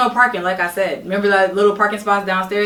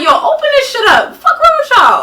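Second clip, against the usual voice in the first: loud, high shouted demand; retold yell; 7 dB louder than usual. Yelling at the roommate through the locked door, loud and high. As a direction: bang on the door and yell at them.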